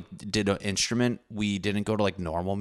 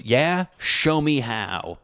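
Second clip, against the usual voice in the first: higher, slow, drawn-out nerd comeback; nerdy teacher retort; 6 semitones higher, 4 dB louder, much slower than usual. Retold or imitated. Band teacher answers the student's insult in slow nerdy challenge. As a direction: play the flustered adult nerd daring the kid. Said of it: "in nerd fashion".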